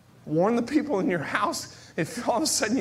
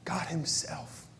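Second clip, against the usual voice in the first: God himself, hushed and breathless; awed whisper; 5 semitones lower, 10 dB quieter, slower than usual. Shown as a demonstration. Preacher giving God himself its weight in a whisper. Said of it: "I don't want to gloss over these words".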